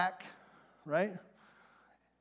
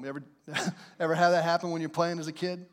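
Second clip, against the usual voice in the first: ordinary delivery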